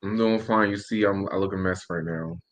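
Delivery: ordinary delivery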